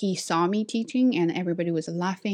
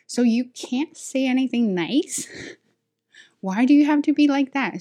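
raised, loud indignant questioning; exasperated; 6 semitones higher, 4 dB louder than usual. Real, not acted. Teacher answering a backhanded compliment, exasperated. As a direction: challenge an unkind colleague in exasperation.

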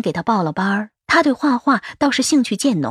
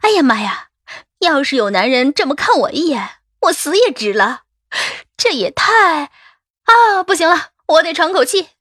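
put-on higher, breathless gushing young colleague; swooning office girl; 6 semitones higher than usual. A narrator's reading. Office girl swooning over a man's loving look, excited. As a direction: gush as if overwhelmed and needing air. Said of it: "公司里的小姑娘拍着胸口".

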